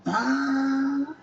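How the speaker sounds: high, slow mock delight; lightly voiced woman's relief; a hint of a delighted woman. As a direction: sigh it out with pleasure.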